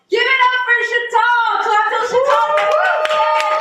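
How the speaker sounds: big stretched hype call; hype announcer. Real, not acted. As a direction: pump the crowd for the next act. Host hypes up next performer.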